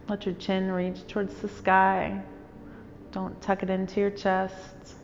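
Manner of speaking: calm, even meditation voice with long pauses; guided meditation. Real, not acted. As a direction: guide listeners unhurriedly into stillness, phrase by phrase.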